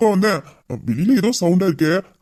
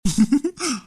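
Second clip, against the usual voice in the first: amused laugh; 8 semitones higher than usual. Acted. Boss laughs at her sulking.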